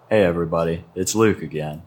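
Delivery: usual speaking voice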